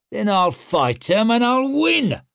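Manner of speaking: high, loud, defiant snarl. Acted. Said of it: "snarled Stour".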